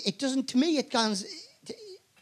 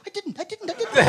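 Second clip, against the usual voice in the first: high, softer flustered denials; Hugh Grant; 10 semitones higher, 6 dB quieter than usual. Retold or imitated. Fellow nominee denying it over and over, high mock protest. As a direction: protest repeatedly in a high little voice. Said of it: "He's going on Hugh Grant going".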